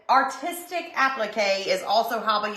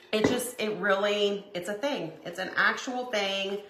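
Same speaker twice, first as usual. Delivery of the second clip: ordinary talk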